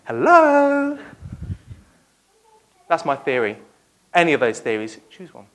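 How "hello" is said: high, bright casual greeting; breezy hello. Pretend. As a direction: pop up with a light hi. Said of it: "he's not coming like".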